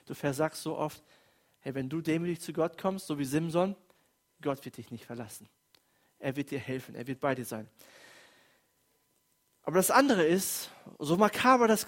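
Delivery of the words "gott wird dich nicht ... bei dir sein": reassuring. Real, not acted. Pastor reassures the congregation.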